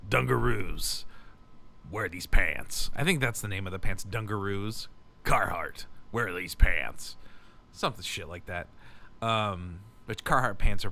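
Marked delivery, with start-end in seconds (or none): 0.1-2.9 forced low, put-on smoker's voice; forced cool voice. Shown as a demonstration. Showing the voice has to be forced, put-on low cool voice. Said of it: "force it to sound like this". 4.0-7.2 low, slow cool-guy pitchman; forced cool voice. Pretend. Naming workwear pants as the cool smoker, forced low voice. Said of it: "force it to sound like this".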